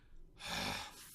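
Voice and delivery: long exasperated sigh; heavy giving-up sigh; weary sigh. Retold or imitated. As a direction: sigh like a defeated imp. Mixie gives up.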